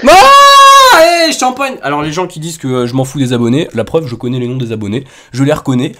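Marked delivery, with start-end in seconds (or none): 0.1-1.7 high, loud victory scream; triumphant celebration. Real, not acted. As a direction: scream it like you just won. Celebrates naming the subscriber correctly.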